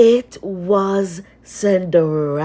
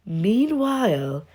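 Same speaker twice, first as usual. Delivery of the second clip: ordinary delivery, nothing marked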